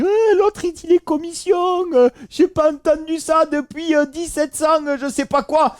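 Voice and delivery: squeaky mock of a viewer; mocking commenter. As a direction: parrot the mocker in a silly high voice. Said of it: "on me dit".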